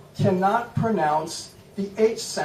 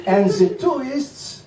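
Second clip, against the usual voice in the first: higher, hammed-up French accent held through; mock French accent; 5 semitones higher than usual. Shown as a demonstration. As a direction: keep up the silly French accent. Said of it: "you simply speak like this".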